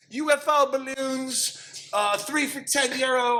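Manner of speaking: high, slow hawker's sales call; street hawker. Retold or imitated. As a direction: drag the pitch out like a street seller. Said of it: "he's like".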